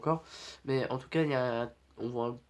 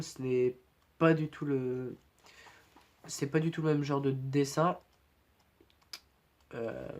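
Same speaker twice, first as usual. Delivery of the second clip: ordinary delivery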